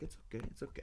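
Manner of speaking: very soft, hushed retold reassurance; soft consoling. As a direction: comfort someone under your breath. Retold mourners comforting him.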